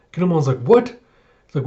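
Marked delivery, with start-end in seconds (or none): 0.6-1.0 high, loud startled what; imitating stunned disbelief; shocked what. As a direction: hear that a friend was eaten. Kinemon shocked.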